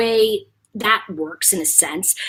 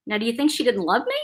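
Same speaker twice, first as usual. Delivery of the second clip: ordinary talk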